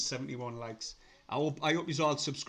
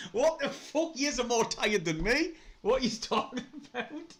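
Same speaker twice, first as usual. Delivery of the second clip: incredulous; 9 semitones higher, 3 dB louder than usual. Real, not acted. Reacts to chat's jokes.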